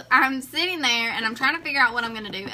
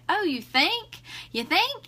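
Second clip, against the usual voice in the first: sarcastic; 6 semitones higher, slower than usual. Retold or imitated. Sarcastic retort to the agent.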